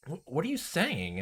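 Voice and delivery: put-on high girlish Rena voice, slow; creepy sweet girl asking. Rena asking Keiichi, high girlish voice.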